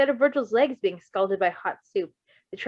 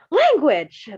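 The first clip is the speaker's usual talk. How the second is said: much higher and louder mock shock; playful gasp; 7 semitones higher, 9 dB louder than usual. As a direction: scold him in mock horror. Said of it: "the doctor gasped playfully".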